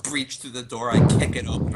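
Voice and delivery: ordinary talk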